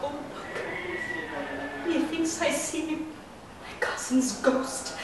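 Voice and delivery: barely audible, haunted hush; very soft, slow horror; Juliet. Acted. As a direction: breathe it slowly, as if seeing a ghost.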